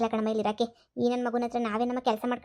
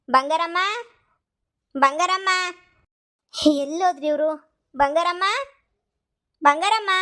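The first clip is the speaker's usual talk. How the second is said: louder, higher calls, last one up high; calling out; 5 semitones higher, 3 dB louder, slower than usual. Acted. Mistress searching the house for the maid, shouting her name. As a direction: keep calling her name, getting impatient. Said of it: "ಯಾಕೆ ಹಿಂಗ್ ಕಿರ್ಚ್ಕೊತಾ ಇದೀಯಾ?".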